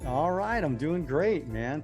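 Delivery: usual speaking voice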